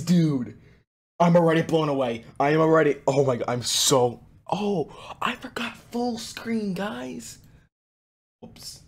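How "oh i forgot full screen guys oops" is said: whispering. Real, not acted. Realizes mistake, whispers aside.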